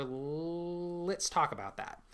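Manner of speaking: high, slow, stretched first sound; stalling hesitation. Shown as a demonstration. Dungeon master swapping a refusal for discussion, drawn-out stalling. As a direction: hold the first consonant long, then ease into the offer. Models stalling instead of saying no.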